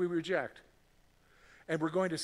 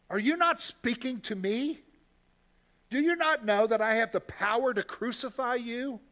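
higher and louder, demanding; pressing question; 6 semitones higher, 4 dB louder than usual. Acted. Pilate demanding an answer from Jesus, forceful. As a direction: Pilate leaning on a silent prisoner.

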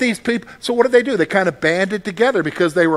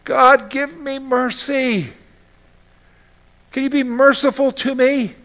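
pleading prayer; 6 semitones higher than usual. Retold or imitated. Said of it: "all they can do is just say".

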